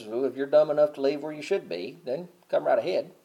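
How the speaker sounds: ordinary delivery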